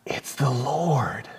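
slow hushed whisper; awed whisper. Acted. Disciple whispering to Peter in slow, awed wonder. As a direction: breathe it out in wonder. Disciple recognizing Jesus in awe.

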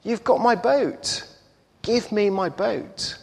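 put-on high, eager boy's plea; excited boy. A narrator's reading. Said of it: "he runs excitedly into the shop".

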